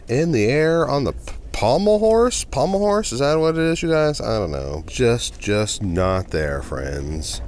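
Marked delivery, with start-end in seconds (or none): none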